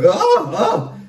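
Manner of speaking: sudden laughing surprise; startled oh. Real, not acted. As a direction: catch yourself, surprised and amused. Startled by a ringing sound.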